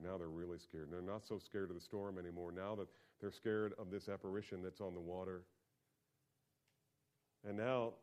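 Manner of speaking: ordinary talk, nothing marked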